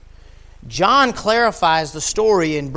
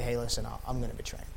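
much lower and softer, conspiring; scheming undertone; sneaky low muttered plot; 7 semitones lower, 12 dB quieter, faster than usual. Acted. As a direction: whisper the betrayal to the priests. Judas plotting with priests.

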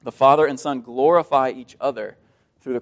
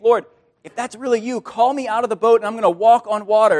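eager Peter; 6 semitones higher, 4 dB louder than usual. Acted. Peter's bold boast.

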